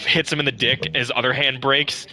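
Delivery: usual speaking voice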